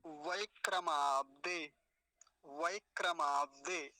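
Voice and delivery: वैक्रमाब्दे slow, opening on "vai"; वैक्रमाब्दे with a clear ai glide; model pronunciation. Shown as a demonstration. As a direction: draw out वैक्रमाब्दे piece by piece. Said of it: "ऐसा इसका उच्चारण होगा".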